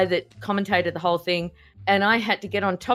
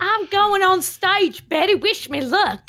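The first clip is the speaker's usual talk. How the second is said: much higher, slow, girlish put-on voice; mock giddy bridesmaid; 12 semitones higher, 4 dB louder, slower than usual. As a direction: play an over-excited bridesmaid heading on stage.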